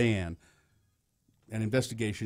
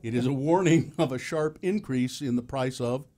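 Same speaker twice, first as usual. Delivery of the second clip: normal talking voice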